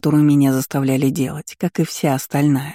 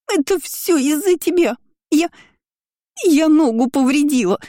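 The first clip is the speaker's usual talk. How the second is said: much higher, furious blame; shrill accusation; 11 semitones higher than usual. Acted. Hurt cousin screams blame at the heroine, much higher and furious. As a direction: wail it at her, hurt and furious.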